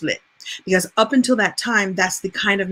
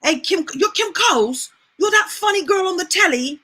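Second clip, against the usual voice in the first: put-on high-pitched, thick London British accent; excited London fan greeting, thick accent; Idris Elba; 8 semitones higher than usual. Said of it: "very thick accent".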